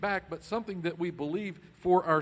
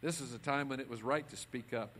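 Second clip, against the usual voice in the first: normal talking voice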